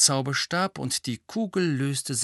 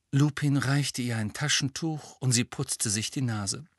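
ordinary delivery, nothing marked

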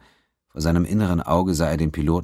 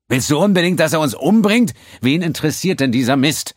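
high and loud reproach; angry rebuke; 12 semitones higher, 7 dB louder than usual. A narrator's reading. Quinn furious at the accountant for provoking the pirate. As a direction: snap at him to shut up.

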